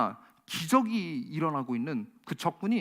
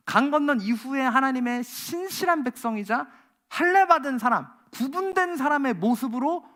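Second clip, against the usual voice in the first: rising fiery preaching; 4 semitones higher, 4 dB louder than usual. Acted. Climactic preaching.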